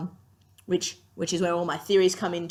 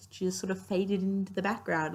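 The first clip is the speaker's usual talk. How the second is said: ordinary talk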